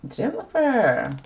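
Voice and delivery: high, long drawn-out name in mock shock; scandalized surprise. Real, not acted. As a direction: stretch the name out high, as if catching her out. Surprised by the girlfriend card.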